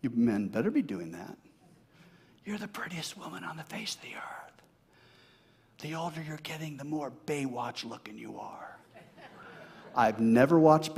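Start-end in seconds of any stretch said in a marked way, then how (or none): 2.4-4.6 unvoiced, much softer whisper; whispered compliment. Shown as a demonstration. Husband whispering to his wife, softly. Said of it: "You whisper". 5.8-8.8 much softer tender murmur; soft whisper. Pretend. Husband whispering to his wife, softly.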